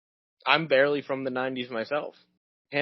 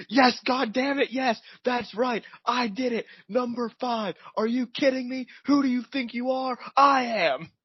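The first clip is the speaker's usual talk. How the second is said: high, slow, yelling bowler's outburst; retold triumphant yell; 11 semitones higher, 3 dB louder than usual. Bowler yelling at a heckling fan, high and loud. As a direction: belt it out like a bowler who just won. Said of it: "Pete Weber goes".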